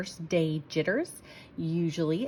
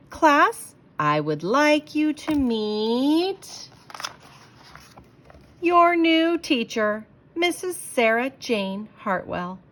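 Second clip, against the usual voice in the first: slow, higher, louder announcement; dramatic reveal; 7 semitones higher, 6 dB louder, slower than usual. Acted. Principal introducing new teacher, dramatic slow reveal. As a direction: build up to the big reveal slowly.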